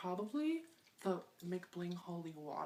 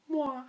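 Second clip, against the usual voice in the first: loud smacking air kiss, pitched up; 7 semitones higher, 11 dB louder than usual. Acted. Vlogger blowing a kiss to viewers, loud and high. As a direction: blow a big smacking kiss.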